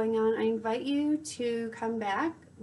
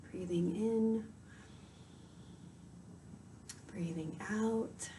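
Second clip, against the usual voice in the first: guided meditation; 9 dB quieter than usual. Acted. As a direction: softly guide the listener into calm, unhurried.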